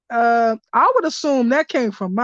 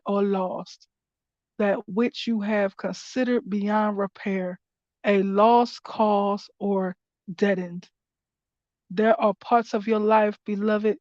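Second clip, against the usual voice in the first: measured, lower reading of the card's message; 3 semitones lower than usual. Acted. Reader shares the card's message with viewers, in a calm reading voice. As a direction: read the guidance aloud like scripture. Reading the oracle card text.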